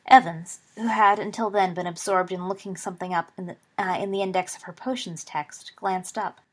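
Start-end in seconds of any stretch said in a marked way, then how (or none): none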